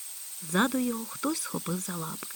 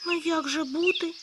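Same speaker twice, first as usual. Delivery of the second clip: higher, helpless despairing plea; a hare who has made a promise he regrets; 9 semitones higher, 5 dB louder than usual. Acted. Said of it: "з подивом, у повному розпачі". (у повному розпачі, вище)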